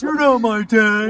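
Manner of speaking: loud, drawn-out mock yell; hammed-up protest, pitched high and stretched slow; mock teenage outburst. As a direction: yell it like an overdramatic sulk. Mockingly acts out Batman's line.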